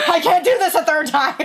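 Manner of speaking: high, loud, laughing it out; laughing exasperation. Retold or imitated. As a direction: toss it off with a laugh. Retold relief.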